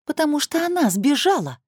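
high excited cry on она; excited exclaim. A narrator's reading. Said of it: "воскликнула она, наверное, громче".

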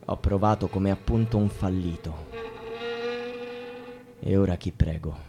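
very soft, low, nearly vanishing voice; defeated murmur. Acted. Man whose prayers failed, defeated murmur. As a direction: let the prayer die away in defeat.

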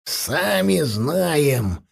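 drawn-out dwarf growl; gruff snap. A narrator's reading. Dwarf answering the panicking elf, gruff snap. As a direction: growl it slowly, annoyed. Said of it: "рявкнул гном".

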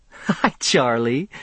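slow giggly greeting; giggling. A narrator's reading. Faye greeting Charlie late at night, giggling. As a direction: giggle out the hello. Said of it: "she giggled as she saw me".

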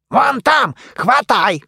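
shouting. A narrator's reading. Mumiy yelling during the chase.

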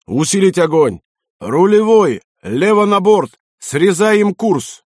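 high, loud string of battle orders; urgent captain's calls, last one drawn out; shouted commands. A narrator's reading. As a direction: shout orders to gunners and helmsman.

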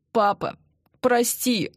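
a touch of tearful pleading; pleading apology. A narrator's reading. Beaten son asking his father to forgive him, pleading. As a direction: beg forgiveness, rising on the plea.

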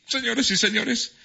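booming high game-show shout over music; mock announcer. Host crowns Adriana Cruz the winner, game-show shout.

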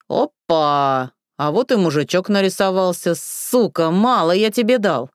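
loud, sneering threat; drunken aggression. Acted. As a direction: snarl at the stranger. Drunk ex confronts neighbour.

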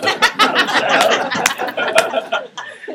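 breathy unvoiced laugh after a mix-up; real burst of laughter. Real, not acted. Speaker laughing at own mistake, real laughter. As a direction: laugh out at your own slip, high.

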